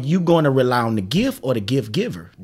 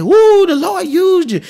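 excited praise; 13 semitones higher than usual. Retold or imitated. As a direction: mimic fans gushing after a sermon.

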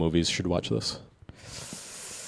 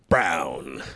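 movie trailer voice; 5 semitones lower than usual. Pretend. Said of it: "trailer voice going".

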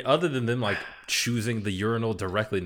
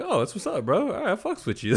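lightly acted, higher-voiced friendly approval; instant buddy greeting; 11 semitones higher than usual. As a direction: act out guys becoming instant friends. Acting out instant male friendship.

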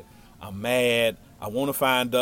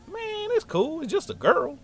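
high-pitched mock brush-off; dismissive reassurance. Friend telling heartbroken boy it's nothing, high dismissal. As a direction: wave it away in a raised comic voice.

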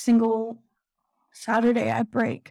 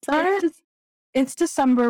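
emotional, higher-pitched apology, then gathering yourself; getting emotional; 5 semitones higher than usual. Real, not acted. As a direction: apologise as emotion catches you, then restart haltingly. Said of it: "I'm getting emotional".

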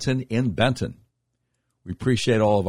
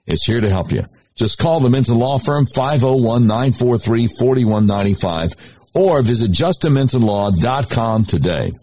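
radio ad read; 8 dB louder than usual. Acted. Host reads sponsor ad.

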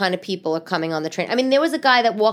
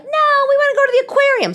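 put-on very high, loud children's whine-shout; excited kids; 7 dB louder than usual. Daughters begging mother to skip school, high loud protest. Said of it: "they were like".

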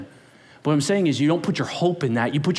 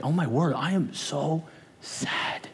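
mock sad; 3 semitones lower, 6 dB quieter, slower than usual.

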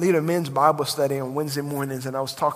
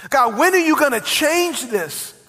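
higher and louder, driving the sermon home; preaching at full force; 6 semitones higher, 7 dB louder than usual. Acted. Pastor stirring his congregation, preaching at full force. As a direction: preach it big and rising to the crowd.

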